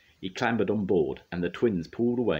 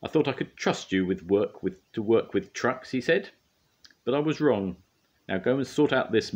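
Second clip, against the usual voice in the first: severe; 6 semitones higher, 4 dB louder than usual. A narrator's reading. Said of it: "he spoke severely to Bill and Ben".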